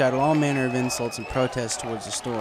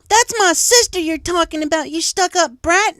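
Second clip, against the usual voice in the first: loud, high enraged filly; furious scream; 10 dB louder than usual. Acted. Filly screams at bully defending sister, furious. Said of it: "Apple Bloom screamed".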